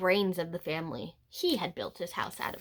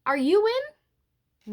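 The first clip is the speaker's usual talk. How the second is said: high sing-song call; 9 semitones higher, 3 dB louder than usual. Retold or imitated. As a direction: call sweetly at the door. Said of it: "so I called".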